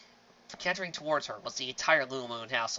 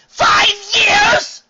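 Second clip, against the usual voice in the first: high, loud shocked cry; outraged shriek; 21 dB louder than usual. Acted. Sister learning the engagement is five years old, outraged shriek. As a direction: shriek it in disbelief. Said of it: "went from annoyed to outraged".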